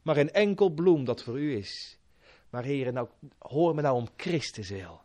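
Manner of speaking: earnest pleading prayer to God; fervent prayer. Acted. Preacher praying aloud before congregation, earnest pleading. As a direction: pour out the prayer humbly.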